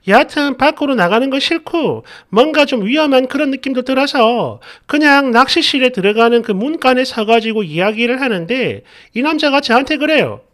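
normal talking voice

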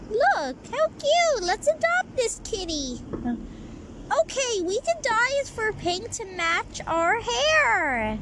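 high, excited gushing over a kitten. Acted. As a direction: squeal over the cute kitty. Girls gush over the kitty.

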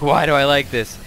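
lightly voiced bewildered cat; a touch of playful panic, imitating; mock freak-out. Owner imitating her cat freaking out at being petted, mock freak-out. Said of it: "she'd freak out and be like".

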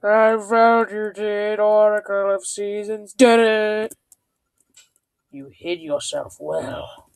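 slow, drawn-out villain proclamation; hammed-up dark general, stretching each word. General of Darkness confronts the oracle he hunted, hammed-up villain voice. Reads the villain's game dialogue.

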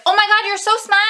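high, loud mock Boston "you're so smart"; mock Boston accent. Vlogger teasing her sister in a Boston accent. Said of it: "everybody started laughing so hard".

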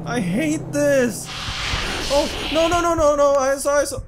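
high panicked cries; terrified, shouting no. Real, not acted. Ghost appears again.

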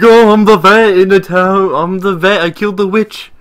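mocking quote. Player imitating a dumb rival, lightly mocking. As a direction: lightly mimic a dim boaster. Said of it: "He's like".

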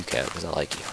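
ordinary talk

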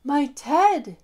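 loud, gasping cry of relief; finding what was lost; gasp of joy. Acted. The bear finds his lost teddy and gasps. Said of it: "gasped the bear".

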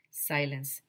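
plain reading voice

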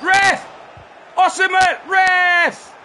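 high furious shout at the ref; appealing to referee. Real, not acted. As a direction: yell for the foul call. Yelling at the referee.

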